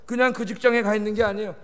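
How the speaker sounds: ordinary talk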